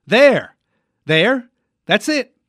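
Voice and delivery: loud, high-pitched eureka; excited breakthrough. Pretend. Host getting the mime's third word, excited breakthrough. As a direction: pounce on the right guess. Hits the right charade word.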